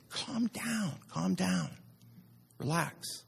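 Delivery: hushed, high soothing voice; God. Acted. God settles panicked Elijah, hushed and calming. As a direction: softly talk someone down from panic. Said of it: "So God calmly tells Elijah".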